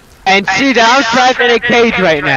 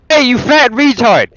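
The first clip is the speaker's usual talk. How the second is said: ordinary talk, nothing marked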